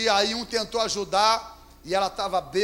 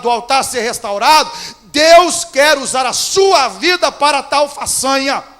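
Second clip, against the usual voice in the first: loud, rising fervent preaching; 3 semitones higher, 9 dB louder than usual. Acted. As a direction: preach it out to the church. Pastor preaching to congregation.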